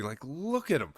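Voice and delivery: normal talking voice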